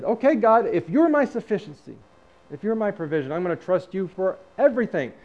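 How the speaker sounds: loud, high-pitched retold declaration; bold declaration to God. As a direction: raise the voice and commit emphatically.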